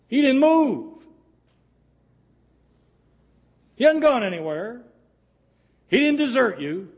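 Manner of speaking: impassioned preacher pleading with the congregation; full-force preaching. Acted. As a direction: preach it out high and loud to the crowd.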